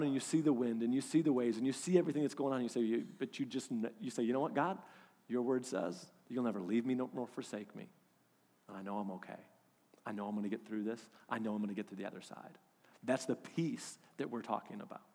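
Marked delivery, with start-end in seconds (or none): 4.2-12.6 calm trust. Pretend. Calm trusting prayer in the storm.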